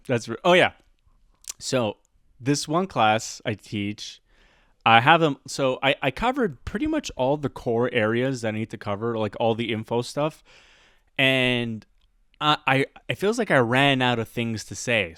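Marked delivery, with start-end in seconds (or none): none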